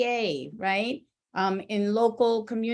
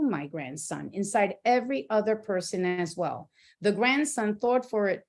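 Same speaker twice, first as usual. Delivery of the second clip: ordinary talk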